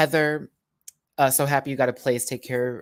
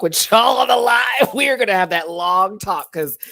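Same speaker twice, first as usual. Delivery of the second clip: laughing excited; 9 semitones higher, 9 dB louder than usual. Real, not acted. Laughing about the drama.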